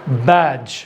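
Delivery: बैज a third time with the d silent; model word. Shown as a demonstration. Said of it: "इसको हम लोग क्या बोलेंगे?".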